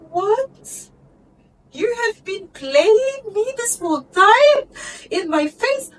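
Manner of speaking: high, loud, cracking disbelief; outraged disbelief. Retold or imitated. Daughter realizing mom played her, high incredulous near-cry. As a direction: shriek it like betrayal just dawned. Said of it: "I'm like".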